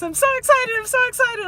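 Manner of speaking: high, loud mock-excited squeal; gushing excitement. Teacher mimicking herself when she started these vlogs, gushing excitement. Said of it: "remember, uh, when I started these vlogs".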